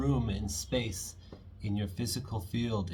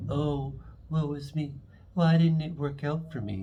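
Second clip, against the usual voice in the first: hammed-up whiny woe, high and a bit louder; mock a moping lament, pitched up; mock self-pity; 6 semitones higher, 4 dB louder than usual. Reader mocking a sulking Aquarius, hammed-up self-pity. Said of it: "And you're not like".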